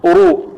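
"أرو" with thick R into a long u; heavy ra with damma. Shown as a demonstration. Teacher shows the damma case, heavy ra. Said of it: "وكذلك إذا كانت مضمومة".

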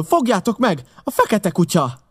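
high, urgent cry; alarmed shout. A narrator's reading. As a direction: shout out to stop a man. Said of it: "kiáltottam föl".

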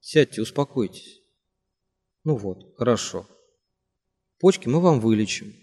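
успокаивающе; soothing. A narrator's reading. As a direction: settle him down gently. Simagin calms Kashinsky down.

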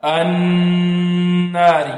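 very slow "النار" with a long hummed n; full ghunna. Shown as a demonstration. Teacher showing complete nasal hum, stretched n in "النار". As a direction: hold the doubled n of "النار" through the nose. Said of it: "والنون المشددة غنتها أكمل".

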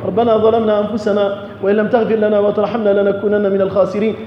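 usual speaking voice

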